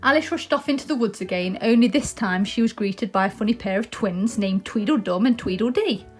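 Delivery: ordinary delivery, nothing marked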